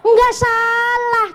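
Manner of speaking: high, slow reply in a put-on voice; a drawn-out, high voice saying it's no fault; mock gentle answer. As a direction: answer like a kindly elder, drawn out.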